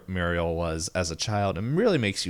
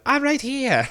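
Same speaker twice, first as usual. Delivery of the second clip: put-on very high little girl voice; 4 dB louder than usual. The girl answering the old man, very high piping voice. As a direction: squeak it like a tiny girl. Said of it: "he's like".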